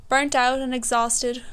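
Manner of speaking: usual speaking voice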